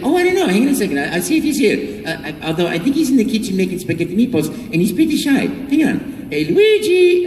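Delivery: high, bouncy Italian plumber voice; Mario voice. Retold or imitated. Mario answering a child at the live show, high and bright. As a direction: play Mario stalling for his shy brother. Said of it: "He said".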